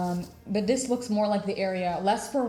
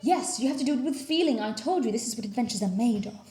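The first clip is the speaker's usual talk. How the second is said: theatrical professor; 6 semitones higher than usual. Acted. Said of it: "she's like".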